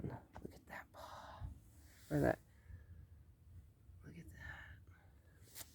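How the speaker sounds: soft unvoiced whisper, slow. Real, not acted. Vlogger whispering while watching tracks in the garden. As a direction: whisper so the animals stay.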